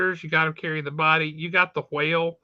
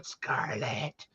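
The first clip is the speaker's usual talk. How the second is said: mock rasp, much softer and lower; ruined rasping voice; 7 semitones lower, 12 dB quieter than usual. Co-host imitating a stroked-out Snake Eyes, mock rasp. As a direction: croak the name out like a broken man. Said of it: "stroking out with his rasped up, nasty, destroyed voice".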